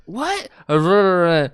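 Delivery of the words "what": very high mock-confused squeak; acted-out high puzzled cry; baffled. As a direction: ask it with the voice leaping up. Acting her confused response.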